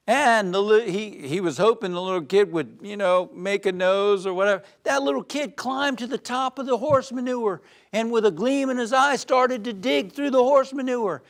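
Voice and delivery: usual speaking voice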